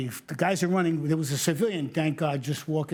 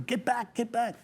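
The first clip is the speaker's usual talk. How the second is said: mild mock yell, higher; retold scream; 8 semitones higher than usual. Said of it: "screaming for him".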